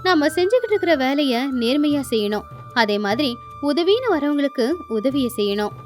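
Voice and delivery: ordinary delivery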